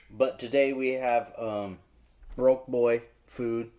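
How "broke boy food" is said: drawn-out title. Acted. Naming the episode, drawn out.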